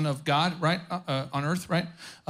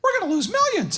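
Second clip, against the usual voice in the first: much higher, light falsetto wife; high mock wife voice; 13 semitones higher than usual. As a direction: squeak it out like a worried wife. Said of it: "That's probably how it was".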